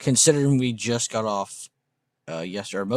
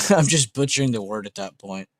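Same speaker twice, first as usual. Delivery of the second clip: normal talking voice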